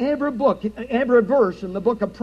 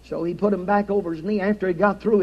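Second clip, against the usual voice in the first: ordinary talk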